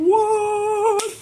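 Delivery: loud, very high falsetto what; sudden high-pitched gasp of shock; shocked squeal. Retold or imitated. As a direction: squeal the word in disbelief. Shocked at mom reveal.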